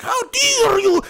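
very high, drawn-out mock outrage; mock mad girl. Imitating a really mad little girl in pigtails, mock fury. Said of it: "she's really mad".

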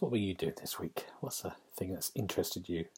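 whisper. Real, not acted. Host asks guest quietly.

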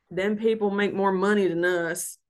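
a light Southern accent, imitating a family friend. Retelling dad's friend at New Year's fireworks, mild Southern accent. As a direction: quote Kelly with a hint of his Southern accent. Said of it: "Southern accent".

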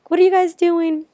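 high, puzzled farmer's wife calling out; lightly voiced wife asking what's going on; mock surprised wife at the door. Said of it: "His wife was at the door".

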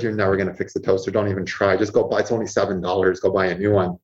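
low, brisk dismissal; matter-of-fact inner voice. Acted. Inner voice advising on a broken toaster, low and practical. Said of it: "my inner voice says".

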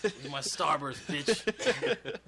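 loud, high, drawn-out joke demand; mock shakedown yell. Joining the joke about a kid shakedown, mock angry shout. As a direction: shout it like a playground debt collector.

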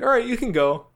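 high, loud imitated dismissal; amused guard impression. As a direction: mimic a guard cheerfully waving someone through. Laughing guard waves him through.

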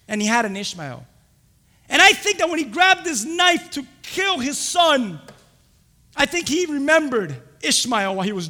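loud, high, driving sermon delivery; full-force preaching. Real, not acted. Preacher to congregation on Abraham's failure, full-force preaching. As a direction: preach it out loud with punched words.

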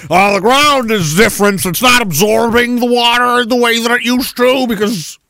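hammed-up explaining voice; mock official excuse. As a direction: drone out a silly official excuse. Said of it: "they say".

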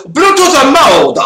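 put-on slow, high robotic Chinese accent; mock gadget announcer, Chinese accent. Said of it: "it talks in a Chinese accent".

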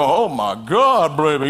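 put-on low, slow, impressed "oh my God, baby"; husband eyeing his wife up and down, low and slow admiration; admiring husband. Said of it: "look up and down at his wife and go".

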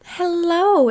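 loud high show-host hello; big hello. Shown as a demonstration. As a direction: belt the greeting like a host. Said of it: "no, that was horrible".

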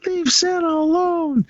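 very high child's voice, mock kid; mock little kid. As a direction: squeak it like a small boy running up.

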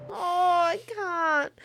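slow, tearful, breaking voice; choked up. Real, not acted. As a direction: barely get it out, overcome. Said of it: "I'm so emotional".

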